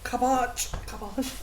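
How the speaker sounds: cabbage with a French "ah" in the second syllable; playfully posh French cabbage; mock French accent. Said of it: "like, French or posh".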